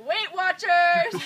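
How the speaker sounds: loud, drawn-out mock scream; high, stretched-out heckling yell; retold scream. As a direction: holler it slowly as a taunt, half laughing. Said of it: "and then I screamed".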